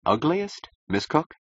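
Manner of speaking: high, rising disbelief; halting, incredulous query; stunned question. Acted. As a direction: echo it back, taken aback. Client's demand stuns the architect.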